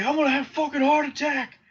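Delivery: fast, high-pitched mock panic; a hammed-up dad sure he is dying; freaking out. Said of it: "My dad's freaking out".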